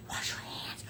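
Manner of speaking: soft whispered aside; hushed reminder to wash. Real, not acted. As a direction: breathe it without voice. Whispered aside about washing.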